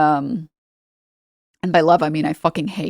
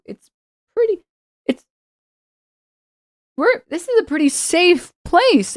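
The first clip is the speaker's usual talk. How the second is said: baffled, high-pitched incredulity; bewildered questioning, voice climbing high; Piers Morgan; 8 semitones higher than usual. Retold or imitated. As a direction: stammer it in high-pitched disbelief. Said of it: "And Piers Morgan's like".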